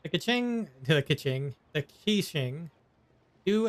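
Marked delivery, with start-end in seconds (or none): none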